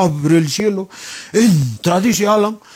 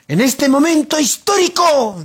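higher-pitched pompous declaration; mock solemn proclamation; 6 semitones higher than usual. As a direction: proclaim it like a politician making history. Imita proclama solemne de político. (proclama solemne en broma)

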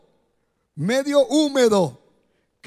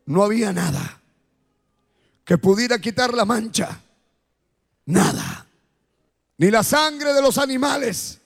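altar call; 5 semitones lower than usual. Acted. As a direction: invite them gently, eyes closed. Altar call over music.

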